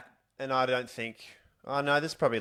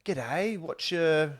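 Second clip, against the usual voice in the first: eager greeter; 5 semitones higher, much slower than usual. Acted. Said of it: "I wanna meet people and go".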